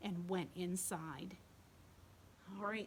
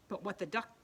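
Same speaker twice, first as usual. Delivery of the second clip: plain reading voice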